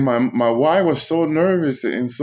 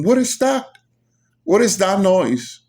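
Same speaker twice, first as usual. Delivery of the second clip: startled question; 8 semitones higher than usual. Retold or imitated. People outside hear the howling.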